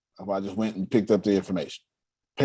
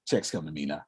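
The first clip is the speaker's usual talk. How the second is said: mantra; 7 semitones lower, 9 dB quieter, faster than usual. Acted. Repeating the motto as a mantra.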